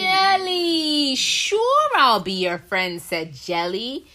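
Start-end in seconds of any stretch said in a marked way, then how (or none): 0.0-3.0 excited. Acted. Jelly delighted to be asked.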